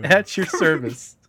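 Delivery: slow, loud mock-formal greeting; mock formal bow. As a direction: present yourself like a pompous officer. Bows in as the sergeant.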